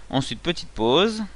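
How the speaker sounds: ordinary delivery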